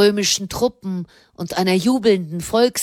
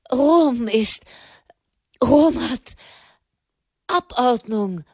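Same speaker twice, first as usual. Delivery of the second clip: halting, higher, out-of-breath broken words; breathless stammer; 5 semitones higher than usual. A narrator's reading. Envoy gasping out news to the pope, breathless. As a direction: force the news out between breaths. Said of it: "brachte Tommaso di Petra heraus".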